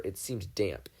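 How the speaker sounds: ordinary delivery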